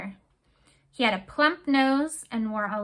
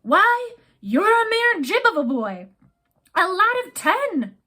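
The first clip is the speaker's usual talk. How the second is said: high, louder scornful rage; captain scorning Jack's youth; angry scorn; 8 semitones higher, 6 dB louder than usual. Acted. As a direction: scoff at the boy. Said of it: "red with anger".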